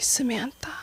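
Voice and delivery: breathy ominous whisper of the name; dramatic whisper. Retold or imitated. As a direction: whisper it like bad news is coming. Dad's ominous text read aloud.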